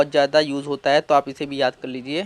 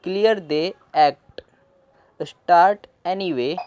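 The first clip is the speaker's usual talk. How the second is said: very slow, word by word; slow reading; 2 semitones higher, much slower than usual. Shown as a demonstration. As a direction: read slowly for learners. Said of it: "इन दोनों शब्द को जोड़ के anyway". (धीरे-धीरे पढ़ना)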